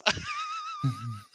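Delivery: very high squeal of laughter; high shrieking laugh. Real, not acted.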